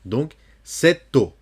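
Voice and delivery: "cette eau" lié /sɛt o/, lentement; liaison T-O. Shown as a demonstration. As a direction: faire sonner le T devant "eau". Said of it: "ils font la liaison entre le T et le O". (liaison lente dans "cette eau")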